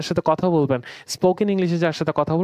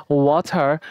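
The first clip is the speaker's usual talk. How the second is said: British ওয়াটার with airy t, no final r; British accent; 3 semitones lower, slower than usual. Shown as a demonstration. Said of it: "আমরা British অ্যাকসেন্টে". (British উচ্চারণে "ওয়াথা")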